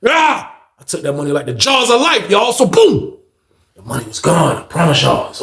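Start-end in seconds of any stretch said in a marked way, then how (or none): 0.0-0.5 short high "ah"; quick high cry while grabbing; excited yelp. Retold or imitated. Storyteller taking that money, high yelp.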